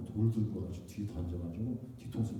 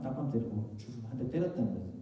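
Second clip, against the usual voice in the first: ordinary delivery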